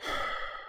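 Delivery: long unvoiced weary sigh; heavy sigh. A narrator's reading. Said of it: "released another heavy sigh".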